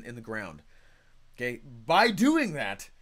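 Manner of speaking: ordinary delivery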